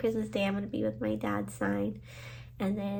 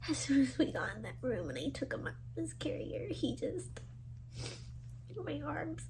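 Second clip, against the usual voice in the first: tearful whisper; 5 semitones higher, 11 dB quieter than usual. Real, not acted. Telling of cat dying in arms.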